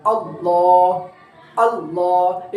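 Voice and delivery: अल्लाह drawn out with a clear opening आ; slow recitation. Shown as a demonstration. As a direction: stretch अल्लाह slowly, stress the glottal आ. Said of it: "ये जो आ, आ की आवाज़ आ रही है".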